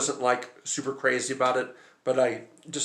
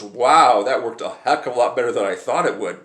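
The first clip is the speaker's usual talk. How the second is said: surprised director; 7 dB louder than usual. Retold or imitated. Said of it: "And Paul Verhoeven goes".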